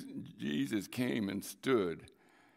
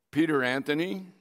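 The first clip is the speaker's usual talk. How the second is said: a hint of a mother calling her son's full name, louder; a mother summons her son by full name, loud stern call; mom calling sternly; 5 semitones higher, 7 dB louder than usual. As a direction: call out his full name like a mom who means business. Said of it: "When she said it in a certain way".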